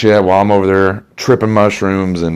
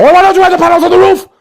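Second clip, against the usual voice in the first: furious German shout; 5 dB louder, faster than usual. Acted. Salesman erupts at customer.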